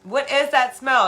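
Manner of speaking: high, loud demanding cry; accusing yell. Retold or imitated. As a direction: call them out loudly. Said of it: "I'm like".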